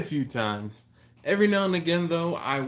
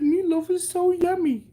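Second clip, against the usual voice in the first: much higher, louder mock voice of his wife; 5 dB louder than usual. Husband mimicking his wife's meatloaf craving, high mock voice. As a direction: squeal it back like a teasing impression of her. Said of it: "She's like".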